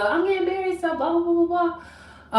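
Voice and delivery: high, sing-song imitation of herself gushing; sing-song it high, mocking your own excitement; mock dramatic. Mocking herself getting married in her head, mock dramatic. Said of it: "I got all dramatic".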